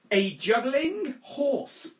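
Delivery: slow, grand reveal; deadpan circus reveal; showman announcer. Acted. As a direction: stretch it out for the punchline. Announces the impression.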